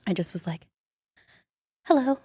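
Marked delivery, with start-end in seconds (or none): 1.9-2.2 put-on shy kid voice. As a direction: ask sweetly like a shy child. Young fan asking John Stamos.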